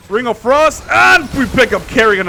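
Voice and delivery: loud, high hype call; excited. Real, not acted. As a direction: shout the big play. Big team fight call.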